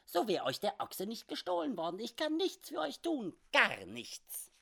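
high, swooping, mock-indignant voice; scoffing little oddball, pitch leaping up; little comic frontiersman. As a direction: refuse him in a quirky squeaky voice. Sam Hawkins refuses to help.

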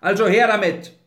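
loud, high, impatient order; angry demand. A narrator's reading. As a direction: demand it back at once. Grinley demands his paper back.

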